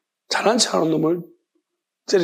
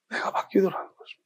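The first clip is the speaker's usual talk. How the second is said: whisper; 7 dB quieter than usual. Real, not acted. Sermon point dropped to whisper.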